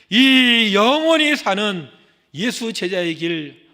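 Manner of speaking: drawn out, loud and solemn; slow emphasis. Acted. Preacher exalting the path of discipleship, slow and loud. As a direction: stretch each word out. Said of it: "slow emphatic phrase".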